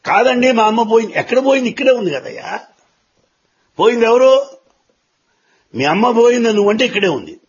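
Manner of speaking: high, pressing back-and-forth; insistent retold argument. Preacher voices boy and elder disputing mother's death, insistent.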